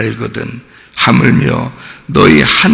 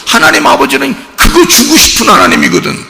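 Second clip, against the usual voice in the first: high and louder, proclaiming 그거 주고 싶은; fervent preaching; 13 semitones higher, 6 dB louder than usual. Acted. Pastor proclaiming the giving God to the church, high and loud. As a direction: proclaim 하나님 아버지는 그거 주고 싶은 하나님이거든 up high and loud.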